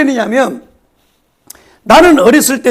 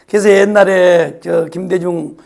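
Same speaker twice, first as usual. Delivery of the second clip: ordinary talk, nothing marked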